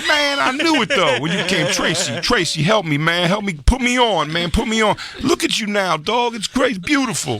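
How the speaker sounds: high, slow reminiscing Tracy; Tracy Morgan impression. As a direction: gush like a proud mentor. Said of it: "He goes".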